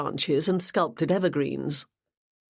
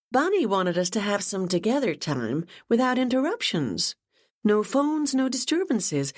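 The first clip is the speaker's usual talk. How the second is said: put-on much higher, bubbly young woman's voice; gushing Ashley; 6 semitones higher than usual. A narrator's reading. Ashley greets her boss Gemma, gushing and much higher. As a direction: gush with delight over the news.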